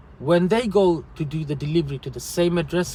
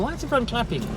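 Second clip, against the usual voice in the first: higher-pitched, baffled question; retold bewildered thought, pitched up; puzzled; 8 semitones higher, much faster than usual. Delivery driver recalls wondering at the applause, puzzled. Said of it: "I didn't know why everyone was clapping".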